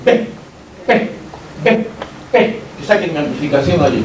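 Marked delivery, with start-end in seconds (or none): 0.0-2.6 P, P, P, P each as a crisp lip burst; P repetida; repeated P. Shown as a demonstration. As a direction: drill P slowly, one pop per beat. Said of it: "Si pronuncian P".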